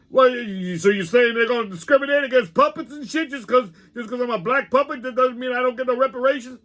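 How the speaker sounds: high, cocky puppet voice, indignant; a brash puppet arguing about reparations; Pop the puppet. Acted. Brash puppet arguing with host, high and indignant. Puppet voice against host's plain talk.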